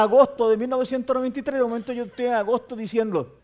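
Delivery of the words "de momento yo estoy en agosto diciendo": normal talking voice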